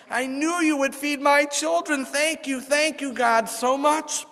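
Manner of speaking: high, slow heartfelt thanks; grateful thanks. Acted. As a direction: thank God with overflowing joy.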